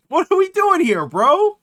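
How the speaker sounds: high-pitched incredulous plea; exasperated disbelief. Real, not acted. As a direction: demand it, totally exasperated. Ranting at the message writer.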